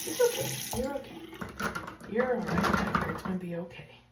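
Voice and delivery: loud, soothing over the cries; loud reassurance. Real, not acted. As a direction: call it out to calm her. Calming a crying kitten loudly.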